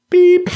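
high, loud beep; imitated machine beep. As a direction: squeak it out like an answering machine tone.

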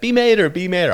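high-pitched, hammed-up Southern accent; bad Southern accent. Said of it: "doing a bad Southern accent".

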